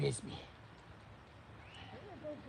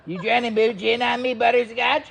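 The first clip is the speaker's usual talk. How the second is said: higher, louder playful voice for the dog; talking to the dog; 6 semitones higher, 8 dB louder than usual. Real, not acted. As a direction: tease the dog in a raised sing-song.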